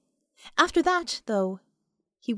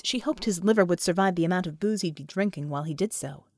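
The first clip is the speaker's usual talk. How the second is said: usual speaking voice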